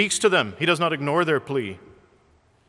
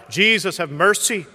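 louder, higher cry for mercy; mild mock cry out; 6 semitones higher, 5 dB louder than usual. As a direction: cry it out, half-raised. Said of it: "their voices were raspy and hoarse".

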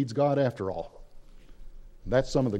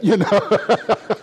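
louder, rising into a real laugh; amused, laughing; 8 semitones higher, 6 dB louder than usual. Real, not acted. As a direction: break up at your own punchline. Laughs at his own joke.

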